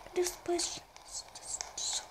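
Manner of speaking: scared hushed whisper. Real, not acted. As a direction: breathe the words, barely voiced. Scared player hunting for pages.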